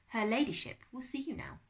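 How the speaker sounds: put-on French-accented servant's announcement. A narrator's reading. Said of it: "It was a French accent".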